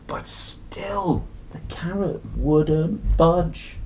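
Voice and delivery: slow whisper of the refrain; storyteller whispering the refrain to children; suspenseful whisper. A narrator's reading. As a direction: hush it, drag it out for suspense.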